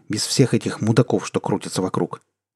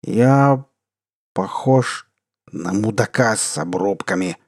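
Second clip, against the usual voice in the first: put-on drunk slurring; slower than usual. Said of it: "заплетающимся языком".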